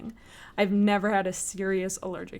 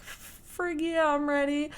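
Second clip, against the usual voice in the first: eager yes; 6 semitones higher than usual. Retold or imitated. Eager reply to wheelchair nurse.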